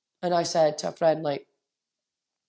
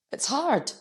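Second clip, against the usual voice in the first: emotional; 8 semitones higher than usual. Real, not acted. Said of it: "emotional today".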